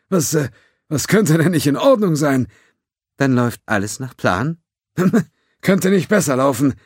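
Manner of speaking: nervous laughter. A narrator's reading. Judge feigning everything is fine.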